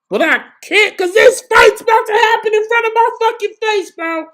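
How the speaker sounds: very high, loud excited yell; can't hold the hype in. Real, not acted. Fan yelling that the fight is right in front of him. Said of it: "I gotta stop screaming".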